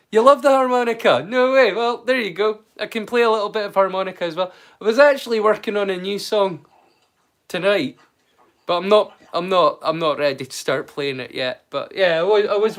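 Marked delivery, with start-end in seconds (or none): none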